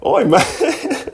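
put-on very high, silly British-style "mate" accent; muddled mock accent. Host laughing at own mixed-up accents, high mock British accent. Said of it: "I'm getting all my accents mixed up".

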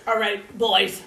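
loud scolding. Acted. Telling two arguing characters off.

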